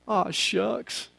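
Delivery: very soft, shy aside as bashful Ruth; bashful whisper. Acted. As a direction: murmur it sheepishly, almost under the breath. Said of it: "blushes and she whispers".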